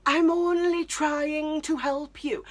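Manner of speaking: high, slow wounded mum voice; imitating mum. Mum protesting she's only helping, high, slow and wounded. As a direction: mimic a hurt, put-upon mother. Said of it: "she's like".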